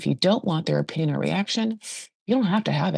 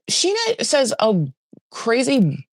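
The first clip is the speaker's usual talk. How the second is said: ordinary delivery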